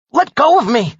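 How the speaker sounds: loud high angry demand; irritated demand. Acted. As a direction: wrench free, demanding. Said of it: "I demanded, irritated".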